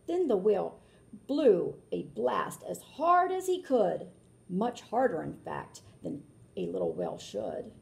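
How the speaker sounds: lilting read-aloud rhyme; storybook narration. A narrator's reading. Reading a rhyming book aloud to children, lilting. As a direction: read it like a picture book for kids.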